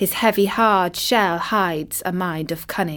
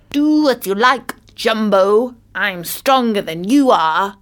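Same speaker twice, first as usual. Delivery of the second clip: cheeky tortoise, loud and raised at first; defiant tortoise; 3 semitones higher, 6 dB louder than usual. A narrator's reading. Small tortoise challenges the elephant, defiant. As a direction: stand up to a giant, loudly.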